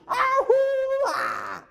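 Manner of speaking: high wild battle cry; hammed-up rallying yell; war whoop. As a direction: let out a crazed whoop.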